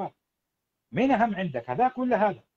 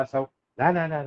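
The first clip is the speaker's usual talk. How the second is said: ordinary talk, nothing marked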